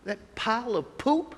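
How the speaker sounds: hammed-up boss laying down the law; bossy order. Boss ordering him to pick up the poop, loud.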